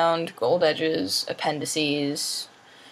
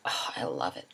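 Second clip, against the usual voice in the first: whisper; 11 semitones lower, 8 dB quieter than usual. Real, not acted. Swooning over leather-bound books.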